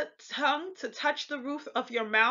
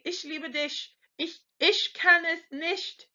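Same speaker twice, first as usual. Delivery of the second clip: higher-pitched Frankish German, ch as "sh"; Frankish dialect; 7 semitones higher than usual. Shown as a demonstration. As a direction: fallback Frankish ish in Ich, dich, nicht. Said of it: "do the Frankish dialect and say".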